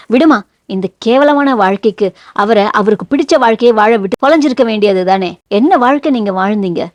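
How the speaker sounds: ordinary delivery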